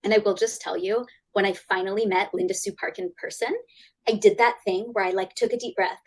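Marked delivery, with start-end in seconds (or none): none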